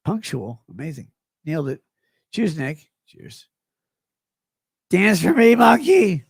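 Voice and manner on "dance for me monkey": mock command. Mocking chat command, loud.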